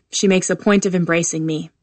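ordinary delivery, nothing marked

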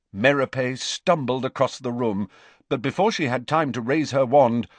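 plain reading voice

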